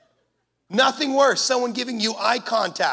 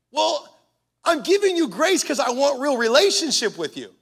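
normal talking voice

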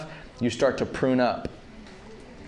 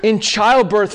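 Paul in anguish; 8 semitones higher, 4 dB louder than usual. Retold or imitated. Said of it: "he goes".

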